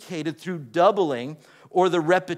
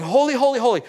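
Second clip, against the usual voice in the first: louder, higher holy, holy; the angels crying out, raised; seraphim shouting; 6 semitones higher, 6 dB louder, much faster than usual. Retold or imitated. Reading the seraphim's cry to the congregation, raised. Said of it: "the seraphim shouting".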